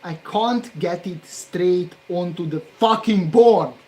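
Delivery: loud, exasperated and pushed; real frustration, nearly shouting; loud frustrated complaint. Real, not acted. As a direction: vent at the board out loud. Frustrated at the board.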